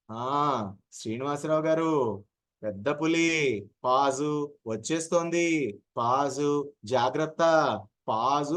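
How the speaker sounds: slow warning with long pauses; absurdly slow warning. Shown as a demonstration. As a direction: pause where no pause belongs, the tiger is already here. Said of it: "అంటే నాకు కష్టం". (అనవసర విరామాలతో నెమ్మదిగా హెచ్చరిక)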